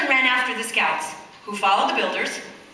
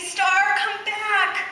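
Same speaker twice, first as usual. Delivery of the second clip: calling out; 9 semitones higher, much slower than usual. A narrator's reading. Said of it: "shouted Davy".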